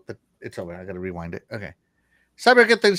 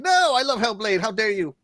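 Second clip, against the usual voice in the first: high-pitched mock indignation; mock outrage; 7 semitones higher than usual. Host defends Hellblade from a commenter, mock outrage. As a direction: protest as if scandalized, high.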